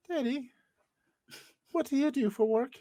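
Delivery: put-on a tiny boy asking his dad; little kid voice. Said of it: "I don't know why he's British".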